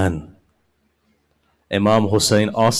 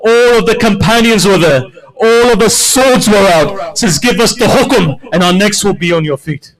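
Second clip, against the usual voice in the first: rallying call; 7 semitones higher, 6 dB louder than usual. Acted. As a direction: stir the companions to loyalty. Habib rallies the companions.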